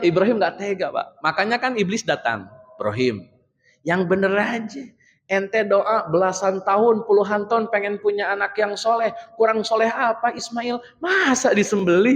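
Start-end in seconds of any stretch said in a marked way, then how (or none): 2.8-12.1 lightly voiced tempting devil, higher; a hint of a wheedling devil. Satan whispering doubts to Ibrahim, mildly wheedling. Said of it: "gitu, kata setan".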